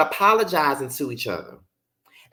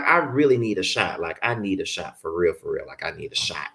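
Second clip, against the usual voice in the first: low, softer and softer mock mumble; imitating him; 11 semitones lower, 6 dB quieter than usual. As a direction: fade into a tired grumble. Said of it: "He was like".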